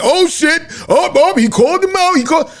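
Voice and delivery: imitating a friend's excited shout; retold excited hype. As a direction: hype it up like a friend egging on a fight.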